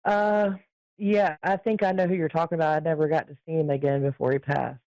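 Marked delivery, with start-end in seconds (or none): none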